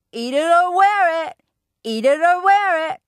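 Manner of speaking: put-on high, loud chanted toddler scream. A narrator's reading. Toddler Fudge screams at his father, a high loud tantrum chant. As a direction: yell it at the top of a tantrum. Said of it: "Fudge screamed".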